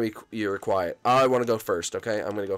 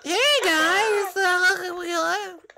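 much higher, crying squeak; squeaky whine; slower than usual. Pretend. Captain Toad greeting the guys in a high squeal.

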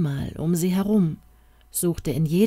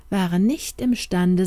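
ordinary delivery

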